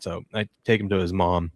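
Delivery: ordinary delivery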